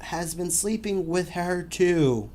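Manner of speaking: slow, deliberate read-aloud of the post; dramatic reading. Acted. Host reads a Yahoo Answers post to co-host, slow dramatic reading. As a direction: read it out like a soap opera confession.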